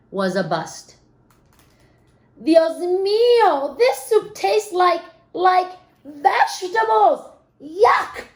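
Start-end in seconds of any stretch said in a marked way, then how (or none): 2.4-8.3 disgusted mom. Acted. Mom tasting the vegetable soup, disgusted. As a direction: spit out the taste like a grossed-out mom. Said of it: "said the mom".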